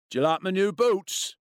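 high, pushy bully question; demanding. A narrator's reading. Bully shoves boot in boy's face, demanding. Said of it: "Ian demanded".

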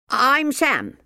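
put-on eager young boy; chirpy little boy. Child character voice.

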